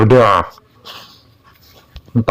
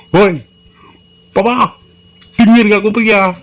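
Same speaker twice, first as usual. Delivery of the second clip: angry shout; 13 semitones higher than usual. Acted. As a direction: yell to wake a silent father. Child yells at silent Papa.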